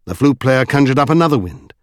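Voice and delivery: ordinary delivery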